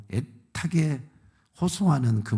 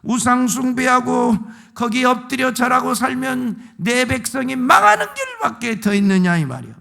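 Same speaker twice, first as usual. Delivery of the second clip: loud, high, driving sermon rebuke; preacher thundering at the congregation; full-force preaching; 8 semitones higher, 6 dB louder than usual. Acted. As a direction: hammer it home from the pulpit.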